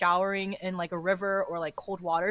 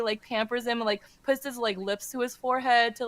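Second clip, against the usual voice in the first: ordinary talk, nothing marked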